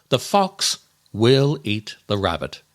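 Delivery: normal talking voice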